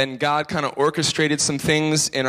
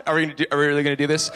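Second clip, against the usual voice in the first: ordinary talk, nothing marked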